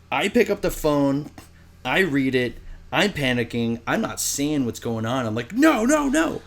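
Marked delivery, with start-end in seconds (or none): 5.5-6.4 high, loud panicked no. Retold or imitated. Reading the breakup text, panicked no. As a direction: cry out no in panic.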